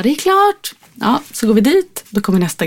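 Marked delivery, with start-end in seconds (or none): 0.0-0.7 high, singled-out call across a room; light mock shout; imitated calling out. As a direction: call it out to a waiting customer. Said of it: "så ropar de bara".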